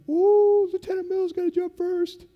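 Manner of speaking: high, loud taunting crowd voice; mock teasing. Other jumpers mocking the lieutenant, high and loud. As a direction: tease him in a singsong.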